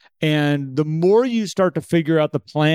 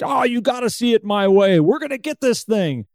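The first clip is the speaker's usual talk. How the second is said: forceful self-urging; 7 semitones higher than usual. Acted. Acted forcing of a goal.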